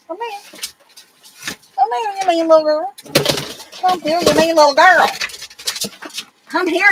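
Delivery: high sing-song calling the dog; pet talk. Real, not acted. Owner calling her mean little dog over, high sing-song coaxing.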